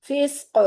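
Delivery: फिस्क with a rebounding echo on the last क़; qalqalah bounce. Shown as a demonstration. Teacher repeating फिस्क with the echo on qaf. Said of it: "पलट की आवाज़ आएगी".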